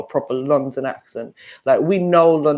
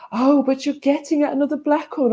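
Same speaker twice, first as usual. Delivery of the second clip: much higher-pitched mocking quote; mimicked accent; 11 semitones higher than usual. Imitating the woman accusing her over race, mimicked accent. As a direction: put on a high voice to imitate her. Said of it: "your accent and how you switched it up".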